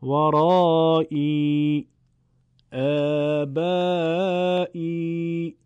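long drawn-out ee, chanted slowly; Quranic long ee. Shown as a demonstration. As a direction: stretch each long ee like a Quran recitation. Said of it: "مثل".

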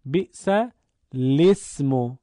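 بئس الاسم sung out slowly, lām given a kasra: bi'sa-l-ismu; slow reading of بئس الاسم; slow recitation. Shown as a demonstration. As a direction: recite it slowly for students to copy. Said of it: "بخونید".

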